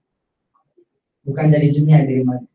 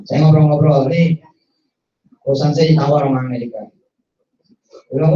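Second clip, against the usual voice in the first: ordinary delivery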